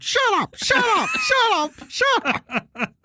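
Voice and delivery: repeated parrot squawk ending in a laugh; parrot screeching. Retold or imitated. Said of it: "the African gray's like".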